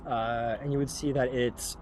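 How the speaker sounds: ordinary talk, nothing marked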